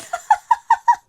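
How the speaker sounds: loud, squealing laugh; high giggling laugh. Real, not acted. Vlogger elated about her business, high loud laugh. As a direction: burst into high giddy laughter.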